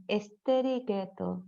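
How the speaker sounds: "Esteriqueto" slow, soft, extra vowels in estricto; drawn-out soft "Esteriqueto"; inserted vowels. Shown as a demonstration. Teacher demoing the faulty form of estricto. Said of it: "en vez de decir estricto".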